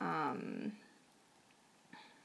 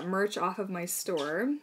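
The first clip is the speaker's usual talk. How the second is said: ordinary talk, nothing marked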